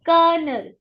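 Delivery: Colonel as "kernel"; model pronunciation. Shown as a demonstration. Teacher answers her own question. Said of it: "What is that?".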